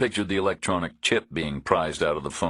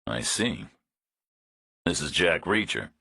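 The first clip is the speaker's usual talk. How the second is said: put-on flat Eastern European accent. A narrator's reading. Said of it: "Some kind of a flat monotone accent".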